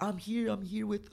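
high, slow girly mimicry; mimicking girlfriend. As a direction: imitate her sweet phone voice.

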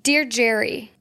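normal talking voice

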